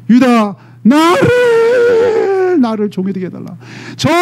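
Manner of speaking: high, stretched sobbing cry of Judah; crying plea. Acted. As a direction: wail out Judah's plea for his brother.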